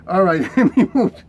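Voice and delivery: laughing as he speaks. Real, not acted. Preacher laughing at his own joke to the crowd, laughing. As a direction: chuckle through the words and move on.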